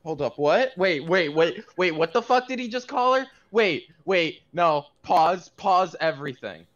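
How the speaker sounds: loud, high, shocked disbelief. Real, not acted. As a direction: stop everything in loud disbelief. Shocked by an NPC's name.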